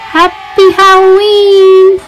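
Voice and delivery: high, slow and loud cheer; cheerful greeting. Acted. Narrator wishes young listeners a happy Halloween. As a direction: sing it out as a festive wish.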